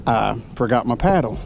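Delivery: ordinary delivery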